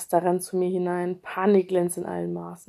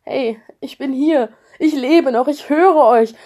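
loud, rising desperate calling out; verzweifelter Ruf; 6 semitones higher, 10 dB louder than usual. Acted. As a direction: call out to people who cannot hear you. Unheard, calling to family. (verzweifelt laut rufen)

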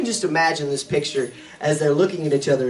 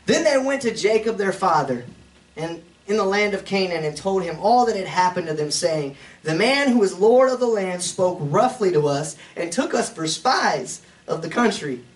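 scripture reading; 5 semitones higher, 4 dB louder than usual. Acted. Reads scripture aloud in sermon.

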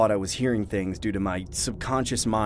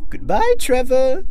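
slow, high, loud clown voice; creepy clown; 11 dB louder, slower than usual. Acted. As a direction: say farewell slowly as a menacing clown. Clown's voice returns for final threat.

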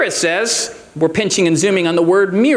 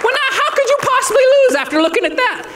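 laughing. Real, not acted.

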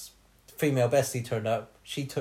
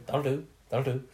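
Hodor-like mumble. Retold or imitated. Retelling a ward neighbour to friends, a lightly voiced mumble. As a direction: mumble it like a man stuck on one phrase. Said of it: "He sounds like, ... Game of Thrones?".

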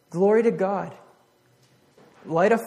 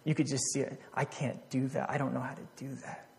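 despairing whisper; 2 semitones lower, 10 dB quieter than usual. Retold or imitated. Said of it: "he just going".

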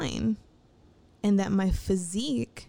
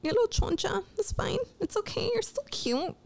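much higher, sassy voice reassuring yourself; sassy self pep talk; 9 semitones higher than usual. Retold or imitated. Looking in the mirror at herself, a high sassy pep talk. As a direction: pump yourself up in a cartoonish high sass. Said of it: "I see myself and I'm like".

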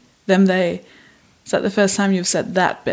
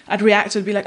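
ordinary talk, nothing marked

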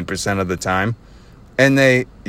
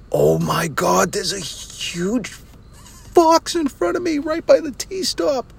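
high, drawn-out astonished cry; amazed; 12 semitones higher, much slower than usual. Real, not acted. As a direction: stretch the words out in soaring, real amazement. Suddenly spots a fox while driving.

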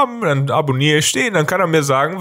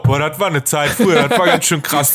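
lightly voiced shallow TV guest; a hint of an empty talking head gushing; faintly mocking celebrity nostalgia; mock panel-show guest. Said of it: "dann sag ich auch".

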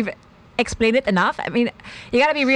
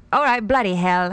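mock British accent. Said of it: "we're gonna do some British".

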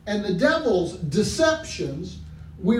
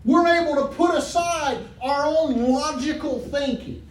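loud, higher fervent preaching; preaching at full force; 4 semitones higher, 3 dB louder than usual. Acted. Preacher urging the church to trust God, fervent. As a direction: lift the congregation with fire.

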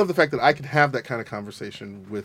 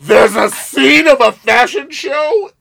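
much higher, louder, slow squeal; retold disbelieving squeal; 13 semitones higher, 9 dB louder, slower than usual. Host retelling the runway number, squealing in disbelief. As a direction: shriek it in astonished delight. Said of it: "I was like".

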